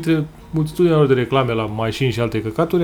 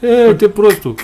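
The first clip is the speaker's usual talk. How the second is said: louder, higher mocking taunt; acted jeer; 6 semitones higher, 6 dB louder, slower than usual. Traveller voices the dog laughing at him, mocking jeer. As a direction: crow it at a laughable fool.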